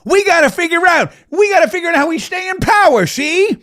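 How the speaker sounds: put-on high, loud old-time mobster voice; hammed-up gangster from an old crime picture; fifties movie gangster. Host mocks politicians scheming in the back room, fifties gangster voice. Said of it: "talking like a gangster from a, from a fifties, uh, movie".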